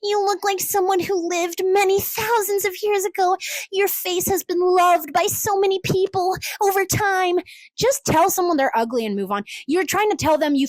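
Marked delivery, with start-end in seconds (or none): 0.0-7.4 higher, drawn-out fake flattery; mock gushing commenter. Commenter flattering someone's old-fashioned face, mock gushing. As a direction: imitate an over-awed admirer.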